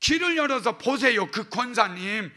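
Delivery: loud, high, desperate pleading; urgent loud prayer. Shown as a demonstration. Pastor shows praying for a suffering deaconess, loud and urgent. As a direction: cry out to God in a hurry. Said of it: "문제 있을 때는요".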